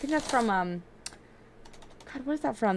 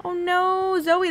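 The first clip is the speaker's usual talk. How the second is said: 1 surprised; 10 semitones higher, 5 dB louder, much slower than usual. Real, not acted. Animal died alert.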